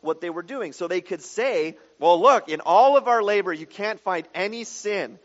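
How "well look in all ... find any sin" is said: loud, smug mock protest; arrogant self-defence. Israelites boasting to God, mock arrogant and loud. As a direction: play the proud Israelites shrugging off blame. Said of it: "I mean, just arrogant".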